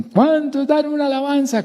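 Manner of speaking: high-pitched hyped-up crowd call; rally the congregation in a high, sustained cry; convention praise leader. Acted. Leader asks a convention for praise, calling out. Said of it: "póngale cuidado cuando dicen".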